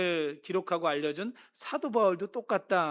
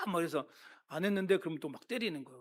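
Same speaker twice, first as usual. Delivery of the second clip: ordinary talk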